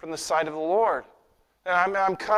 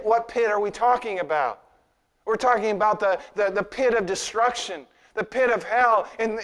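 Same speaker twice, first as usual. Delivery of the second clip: usual speaking voice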